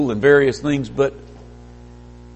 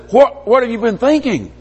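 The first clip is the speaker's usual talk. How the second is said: higher-pitched mock telling-off; mock scolding; 7 semitones higher, 3 dB louder than usual. Scripture wags its finger at the preacher, scolding. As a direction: take them to task as an accuser would. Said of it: "wags its finger in my face".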